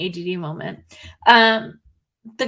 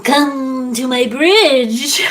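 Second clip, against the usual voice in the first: very slow, loud, drawn-out goat voice; laughing goat man call; 3 semitones higher, 9 dB louder, much slower than usual. Acted. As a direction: lure them slowly onto the bridge. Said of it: "that's your goat voice".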